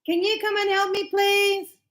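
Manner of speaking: lifted, pleading ask for help; pleading helper voice. Retold or imitated. A son asking his mother for help, in a lifted pleading voice. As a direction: plead sweetly like someone asking a favour. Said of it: "and he goes".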